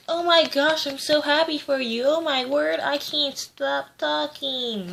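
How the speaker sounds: high, gushing mock of an excited girl; annoying daughter. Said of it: "She was just so annoying".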